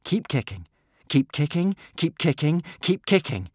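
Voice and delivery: high, insistent repeated urging; urgent urging. A narrator's reading. Boy urging tired swimmers on, insistent.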